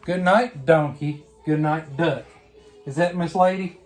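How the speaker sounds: usual speaking voice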